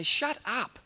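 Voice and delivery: high-pitched mock scolding; lightly acted crowd telling him off; a hint of an irritated onlooker; crowd's rebuke. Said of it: "They say".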